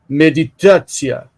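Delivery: "meditatia" with a mock Russian accent. Shown as a demonstration. Teacher shows students how not to say it, Russian accent. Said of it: "You don't say it with a Russian accent".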